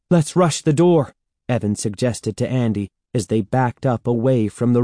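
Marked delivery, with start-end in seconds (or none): 0.1-1.1 high, louder, urgent prompt; urgent suggestion. A narrator's reading. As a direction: propose it in a raised, pressing voice. Children urgently plot escape from glob.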